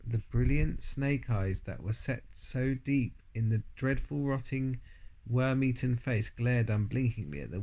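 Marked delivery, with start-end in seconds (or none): none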